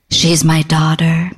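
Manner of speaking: low, slow, breathy purr; breathy flirtation.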